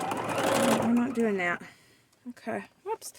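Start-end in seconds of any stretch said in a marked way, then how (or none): 0.0-1.6 firm no. Real, not acted. Firm refusal to the fabric.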